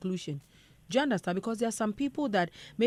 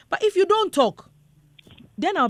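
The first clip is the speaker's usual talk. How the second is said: raised, emphatic voice; 10 semitones higher, 6 dB louder than usual. Real, not acted. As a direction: push the words out louder. Emphatic advice.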